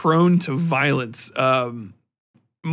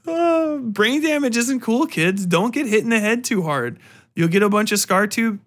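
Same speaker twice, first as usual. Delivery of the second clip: higher, hammed-up warning to kids; mock PSA; 7 semitones higher than usual. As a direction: lecture the kids like a cheesy PSA. Mock public service warning.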